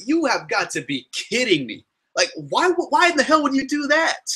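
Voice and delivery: rising to a high, incredulous why; asking how anyone could do that, outraged; exasperated disbelief. Retold or imitated. Telling viewers what he thought of the creep, exasperated disbelief. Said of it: "I'm like".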